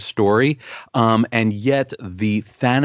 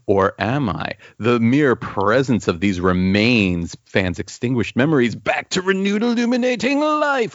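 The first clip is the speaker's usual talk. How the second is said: melodramatic comic-book monologue. Acted. Podcaster reading a hero's ridiculous running commentary, hammed-up melodrama.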